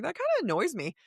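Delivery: high, laughing grumble; laughing annoyance. Retold or imitated. Host joking about rereading while grumpy, laughing. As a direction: complain through a laugh. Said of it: "I'm like".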